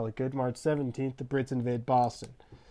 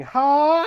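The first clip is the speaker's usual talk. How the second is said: high, loud charging yell; long piercing war cry; battle scream; 13 dB louder than usual. Acted. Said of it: "straight up screaming".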